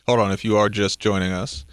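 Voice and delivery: low, smooth radio host read; radio announcer voice. Acted. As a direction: read it like a station ID. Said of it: "He has a really good radio voice".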